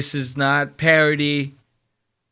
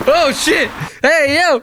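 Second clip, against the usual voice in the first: much higher, loud yelp of surprise; startled shout; 6 dB louder than usual. Real, not acted. Shouting when the friend nearly falls, startled. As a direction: yell out as he stumbles.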